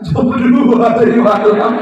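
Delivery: high, loud, slow mock wail; mock wailing widow. Widow wailing her answer to a mourner, hammed up. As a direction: drag it out like a sobbing widow, hammed up.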